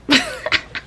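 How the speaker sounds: high squealing laugh. Real, not acted. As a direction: let a high laugh burst out. Laughing at herself.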